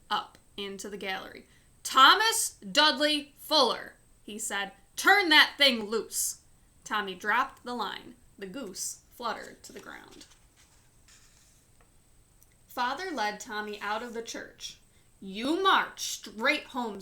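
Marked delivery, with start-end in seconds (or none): 1.8-4.0 loud full-name scolding; angry father's shout. Acted. Father scolds son in church. 5.0-6.4 loud, slow furious order; angry command. Acted. Father scolds son in church. 15.2-17.0 loud, slow angry command; stern order. Acted. Father sends son home.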